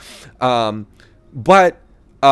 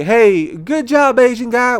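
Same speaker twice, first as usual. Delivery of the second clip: mocking the well-meaning guy; 10 semitones higher, slower than usual. Comedian mocks a stranger's praise, high mock-cheerful voice. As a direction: parody an overeager stranger's praise. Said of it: "Is it the right way to say".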